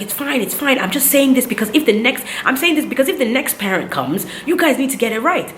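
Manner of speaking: high-pitched, insistent protest; retold upset. Upset mother insisting to hospital staff, high and flustered. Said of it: "So I was just upset".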